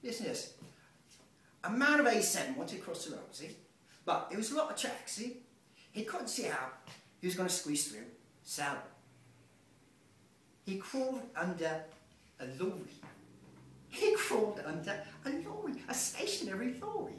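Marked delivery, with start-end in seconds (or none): 1.6-12.9 a light Irish lilt, raised and chatty; Irish impression. Imitating Mike's Irish storytelling lilt, lightly done. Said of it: "kinda talks like this". 13.9-17.1 He crawled under a lorry squawked much higher like a parrot. Retold or imitated. Mimicking Mike's parrot, squawking the line much higher. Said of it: "I saw Mike's pet".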